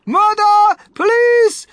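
very high, loud hysterical shrieks; panicked mother shrieking at full force; screaming. A narrator's reading. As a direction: scream for help word by word. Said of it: "screamed Missus Gloop".

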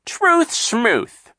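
high, loud scoffing brush-off; dismissive sneer. Acted. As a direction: wave the truth away with contempt. Captain Sham repeats.

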